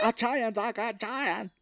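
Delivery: mock Irish accent fighting a mouthful of teeth; struggling Irish accent. Actor excusing bad accent to Doc, failing Irish accent. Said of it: "you're supposed to have an Irish accent".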